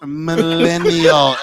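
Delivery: Millennial drawn out syllable by syllable; concentrating hard on Millennial; slow and careful. Real, not acted. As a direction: take Millennial very slowly. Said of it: "I have to go slow".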